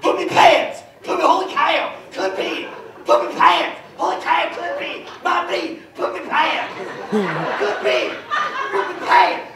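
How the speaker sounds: punchy sportscaster impression; hammed-up announcer voice, jumping in pitch; Harry Carey. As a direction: shout it out in bursts like an excited ballgame announcer. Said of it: "you sound like Harry Carey".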